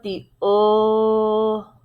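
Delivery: "O" held long as the vowel of "should"; loud, sustained "O"; sounded out. Shown as a demonstration. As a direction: stretch the "O" into a short oo sound. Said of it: "And then the middle part".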